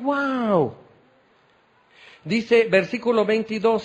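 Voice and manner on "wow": higher-pitched, open-mouthed amazement; awed wow. Acted. Synagogue crowd listening to Jesus teach, awed. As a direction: gasp it out in astonished wonder. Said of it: "con la boca abierta".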